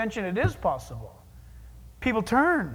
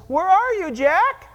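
loud, higher call to someone hiding; 8 semitones higher, 8 dB louder, slower than usual. Acted. God calls out to a hiding sinner, loud higher call. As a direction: shout the name into the bushes.

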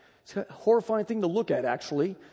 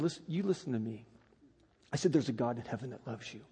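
soft earnest plea; 8 semitones lower, 13 dB quieter, faster than usual. Retold or imitated. Earnest plea to young man.

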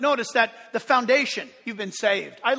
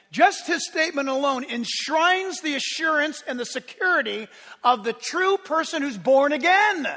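ordinary talk, nothing marked

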